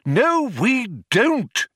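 high, slow exasperated contradiction; irritated exclamation. A narrator's reading. Older philosopher corrects his colleague, irritated exclamation. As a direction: contradict your ally in irritation. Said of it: "exclaimed Magic Thighs in irritation".

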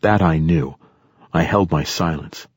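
plain reading voice

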